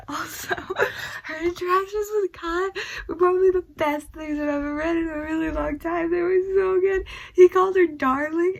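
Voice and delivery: high, loud, laughing gush; gushing excitedly. Real, not acted. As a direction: squeal with delight over the romance. Gushing about the romance.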